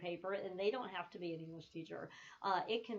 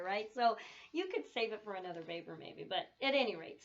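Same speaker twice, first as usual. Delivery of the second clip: usual speaking voice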